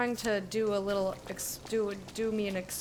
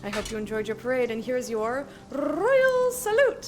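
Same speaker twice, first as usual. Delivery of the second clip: 1 hype announcer voice; 7 semitones higher, 4 dB louder than usual. Acted. Reading game's hype messages.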